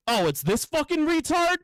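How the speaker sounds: high-pitched mock disbelief; boss's incredulous recognition. Boss's acted sudden recognition.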